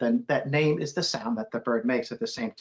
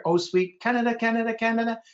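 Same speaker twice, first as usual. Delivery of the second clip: chanted birdsong, rising to a high repeated Canada; held note, then higher rhythmic repeats; sparrow's song; 8 semitones higher than usual. Shown as a demonstration. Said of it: "you hear".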